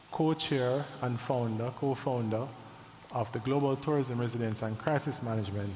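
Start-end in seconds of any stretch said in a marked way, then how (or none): none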